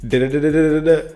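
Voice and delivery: fast, empty da-da babble mocking a politician; quick mock of a long-winded answer; mocking rambling babble. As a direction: rattle off nonsense syllables to mock the waffling. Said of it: "took two fucking minutes to say one sentence".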